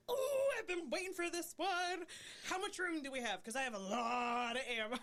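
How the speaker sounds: high, relishing eagerness; gleefully gear up for the hunt; mock eager excitement. Acting eager guy in duet.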